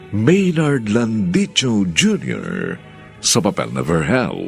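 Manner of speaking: ordinary delivery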